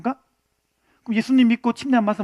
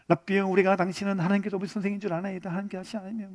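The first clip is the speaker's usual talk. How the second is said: timid quote; 3 semitones lower, 10 dB quieter than usual. Retold or imitated. Nicodemus hesitantly addressing Jesus.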